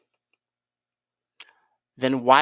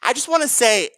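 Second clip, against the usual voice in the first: ordinary talk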